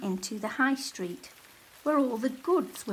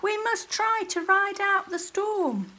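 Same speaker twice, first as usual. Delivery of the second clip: shouting; 11 semitones higher, 4 dB louder than usual. A narrator's reading. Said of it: "shouted Soggy".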